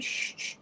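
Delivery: soft, voiceless shushing; quick hushed "shh"; hushing shush. Retold or imitated. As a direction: imitate someone waving others quiet. Father waves her to hush.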